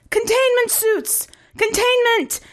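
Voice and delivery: very high, panicky shouted orders. A narrator's reading. Commander ordering crew to evacuate, shouted. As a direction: cry out orders high and strained. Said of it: "the commander shouted".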